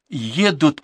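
high-pitched warning; urgent repeat. A narrator's reading. Girl repeats her warning on arrival, urgently. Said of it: "повторила она".

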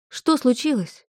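high, startled question. Acted. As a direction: ask it wide-eyed with alarm. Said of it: "вытаращила глаза Стефани". (испуганно-удивлённо, выше)

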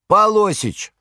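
high, loud desperate call; anguished call. A narrator's reading. Saleswoman calling the manager across the store, loud desperate cry. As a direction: call for help across the shop. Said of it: "повторился отчаянный крик".

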